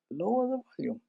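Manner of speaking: drops to a soft, hushed voice. Shown as a demonstration. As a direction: says it quietly as a demo. Demonstrates lowering the volume.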